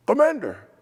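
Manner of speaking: high, loud call of a nickname; imitating a friend's booming hello; hearty greeting.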